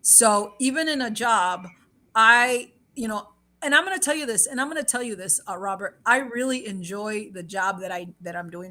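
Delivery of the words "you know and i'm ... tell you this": ordinary talk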